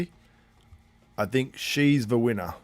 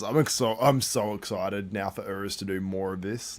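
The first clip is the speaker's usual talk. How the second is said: normal talking voice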